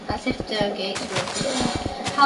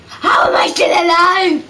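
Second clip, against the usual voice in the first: slow, loud, pitched-up cry of disbelief; shouting in disbelief; 8 semitones higher, 10 dB louder, slower than usual. Real, not acted. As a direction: yell it out, amazed you survived. Shocked at surviving in game.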